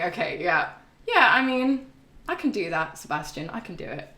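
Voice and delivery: ordinary delivery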